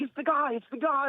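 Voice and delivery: buddy panicking in the car, high mock freak-out. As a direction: squeak it out like a tripping buddy seeing a celebrity. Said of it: "He starts freaking out".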